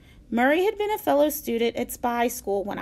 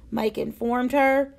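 usual speaking voice

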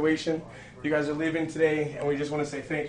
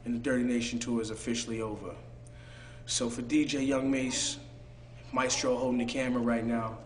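sign-off outro; 4 semitones lower, 5 dB quieter than usual. Acted. Signing off to camera.

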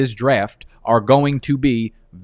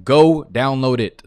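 normal talking voice

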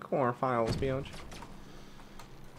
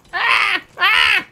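screams; 12 dB louder than usual. Real, not acted. Panicked in a firefight.

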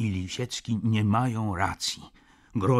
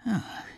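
weary sigh; 13 semitones higher than usual. A narrator's reading. Weary diarist sighs aloud.

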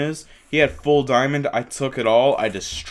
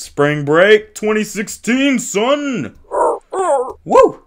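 high, hyped-up holler building to a whoop; rising shout ending in a loud woo; party cheer; 10 semitones higher, 6 dB louder, much slower than usual. Pretend. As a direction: whoop it up for spring break. Hyped cheer about spring break.

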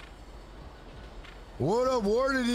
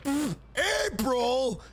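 shocked disbelief; 4 semitones higher, slower than usual. Retold or imitated. Shocked reaction to seeing her.

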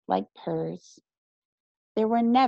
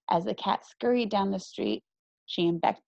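ordinary delivery, nothing marked

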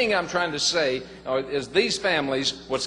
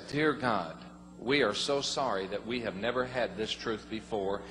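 softer and lower, a humble prayer; hushed prayer; 6 semitones lower, 9 dB quieter than usual. Retold or imitated. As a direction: pray quietly in repentance. Retold prayer with his wife.

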